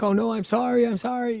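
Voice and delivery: very high, squealed mock pleading; mock panicked apology. Mother-in-law begs at the door, high hammed-up panic.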